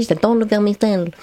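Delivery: j'adore le vermicelle in an imitated Chinese accent, vowels and consonants bent; a streamer mimicking a Chinese accent to show how harmless it was; mock Chinese accent. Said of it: "avec un accent chinois".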